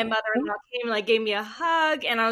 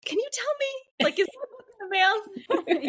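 much higher pleading voice with a laugh; eager plea; 12 semitones higher than usual. Retold or imitated. Messaging her editor about the book, eager and giggly. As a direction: beg the editor, giggling at yourself. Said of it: "I was like".

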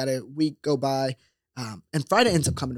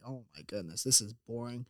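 much softer, low aside; muttered boredom; 3 semitones lower, 10 dB quieter than usual. Retold or imitated. Bored teen in church muttering to himself, softly. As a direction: mutter it under your breath. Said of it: "I'm like".

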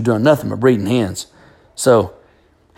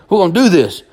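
repeated "We're gonna do this" pushed higher and louder; stern father louder; 6 semitones higher, 4 dB louder, faster than usual. Retold or imitated. Dad repeats to son they fight, louder. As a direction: drive it home, hammering the point. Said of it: "my daddy walks over and goes".

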